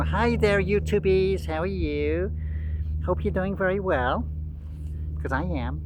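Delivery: high, singsong mock YouTuber greeting; Zachary Michael. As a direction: greet the viewers in a put-on, high host voice. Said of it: "Then you got Zachary Michael".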